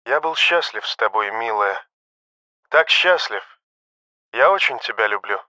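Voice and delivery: soft, intimate confession; tender farewell. A narrator's reading. Doomed pilot to his beloved, tender farewell. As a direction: say goodbye gently and lovingly.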